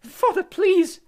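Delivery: put-on high, loud desperate boy; high pleading young voice; pleading son. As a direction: cry out to his father. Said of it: "said the boy".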